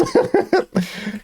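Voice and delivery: squeaky burst of laughter; high giggle. Real, not acted. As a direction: crack up at the idea. Laughs at own idea.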